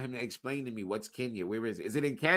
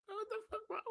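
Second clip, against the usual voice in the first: shocked squeak; 9 dB quieter, faster than usual. Pretend. Mock shocked reaction.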